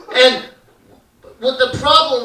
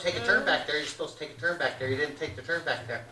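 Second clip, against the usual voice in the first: nagging mom; 6 semitones lower, 13 dB quieter, faster than usual. Retold or imitated. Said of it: "I told him".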